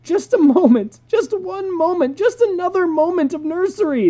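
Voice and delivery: high, loud wailing plea. Acted. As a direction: beg like crying children. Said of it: "they wailed".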